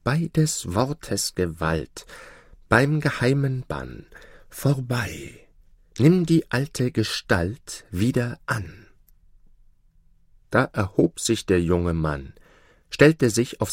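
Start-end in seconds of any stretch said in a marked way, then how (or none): 0.0-8.9 slow, drawn-out spell casting; magic incantation. A narrator's reading. Sorceress breaking the spell on the prince, slow incantation. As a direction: intone each phrase deliberately, pitch lifted after the first. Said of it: "sprach magische Zaubersprüche".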